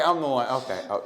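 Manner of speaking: usual speaking voice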